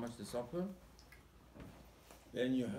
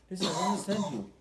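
exasperated rebuke; 6 semitones higher, 6 dB louder, faster than usual. Acted. Hashem voiced answering Moshe.

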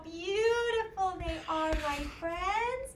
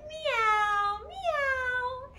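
high, drawn-out meows; playful kitty mewing for children; kitten meow; 6 semitones higher than usual. Shown as a demonstration. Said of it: "What would the kitten say".

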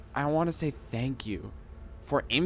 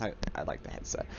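quick unvoiced whisper, confessing; 9 semitones lower, 10 dB quieter, faster than usual. Real, not acted. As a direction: whisper it like a secret admission. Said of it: "I'm gonna be honest".

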